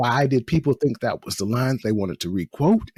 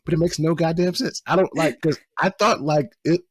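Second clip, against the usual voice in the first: ordinary talk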